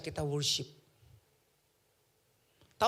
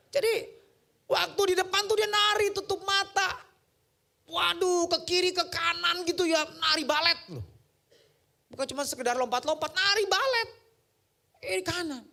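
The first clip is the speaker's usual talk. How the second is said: high, loud amazed retelling; loud, amazed account of the ballet; excited storytelling; 13 semitones higher, 6 dB louder than usual. Retold or imitated. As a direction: marvel aloud at the dance. Excited retelling of wife's dance.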